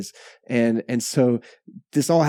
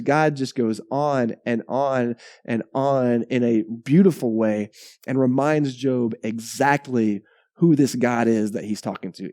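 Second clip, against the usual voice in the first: normal talking voice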